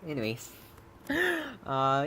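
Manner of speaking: ordinary delivery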